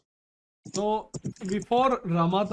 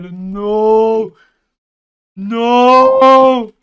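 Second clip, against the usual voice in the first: loud no; 4 semitones higher, 17 dB louder than usual. Real, not acted. Loud dismay in game.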